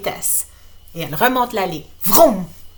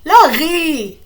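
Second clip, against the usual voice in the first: calling out; 7 semitones higher, 8 dB louder than usual. Acted. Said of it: "dit son frère".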